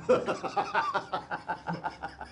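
a short amused burst of laughter. Real, not acted. Laughs at the offer.

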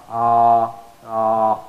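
ah held long, two fathas' length; stretched alif. Shown as a demonstration. Teacher demonstrating the long alif sound. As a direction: stretch the ah out evenly. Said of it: "the voice of alif".

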